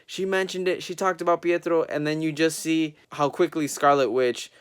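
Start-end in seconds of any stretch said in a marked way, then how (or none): 0.1-1.8 high, breathless fan excitement; freaking out. Retold or imitated. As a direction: gush like a fan who just heard the name. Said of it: "I was freaking out".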